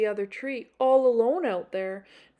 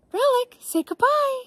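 much higher sing-song pet talk; high sweet voice for a pet; talking to the dog; 6 dB louder than usual. Real, not acted. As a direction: coo it at the dog.

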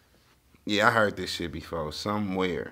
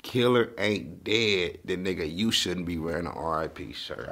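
slow, deliberate quote; 4 semitones lower, slower than usual. Retold or imitated. Reactor quoting a rap line to viewers, slowly. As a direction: spell the lyric out slowly, word by word.

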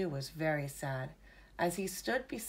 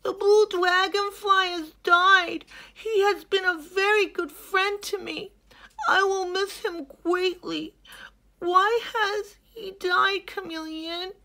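high, loud, tearful hippo voice; grieving young hippo; 11 dB louder than usual. Acted. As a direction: tell of a friend's death. Hilmi grieves aloud.

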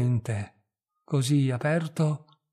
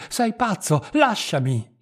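higher, urgent cry to let go; frightened protest, raised; 4 semitones higher, 4 dB louder than usual. A narrator's reading. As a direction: push him away with your voice. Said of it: "gridò lei".

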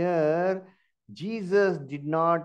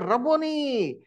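mock shout; 8 semitones higher, slower than usual. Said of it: "Immediately she shouted".

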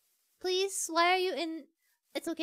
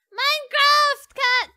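loud, high, slow drawn-out call; 8 semitones higher, 12 dB louder, slower than usual. Real, not acted. Player hailing the Minecraft cat, calling out loudly. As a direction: holler it after the cat.

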